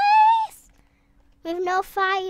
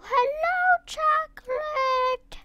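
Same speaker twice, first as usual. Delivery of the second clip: sing-song greeting; 10 semitones higher, slower than usual. Real, not acted. Greets her friend Chocolate.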